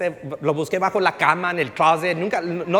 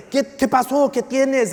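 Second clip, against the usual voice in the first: higher, mildly shouted questioning; raised-voice questions; 7 semitones higher, 4 dB louder than usual. Retold or imitated. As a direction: imitate a loud, high questioner. Same loud man questions him publicly.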